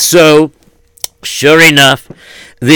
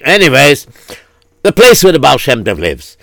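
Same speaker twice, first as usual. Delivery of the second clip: usual speaking voice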